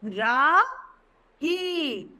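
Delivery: ordinary delivery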